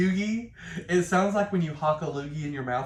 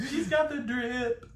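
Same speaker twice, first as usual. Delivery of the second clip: laughing; 7 semitones higher, 4 dB quieter, slower than usual. Pretend. Mimicking the slang, laughing.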